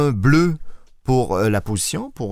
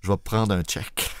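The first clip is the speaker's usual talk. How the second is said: softer mock-desperate plea; mock despair; 4 semitones lower, 6 dB quieter than usual. Asking Fred for a loan, softer hammed-up despair. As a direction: beg quietly, hamming up the misery. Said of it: "le désespoir dans la voix".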